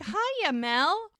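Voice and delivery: high sing-song greeting; sing-song hiya. Retold or imitated. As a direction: gush the hello up high. Charmaine greets sweetly, extra.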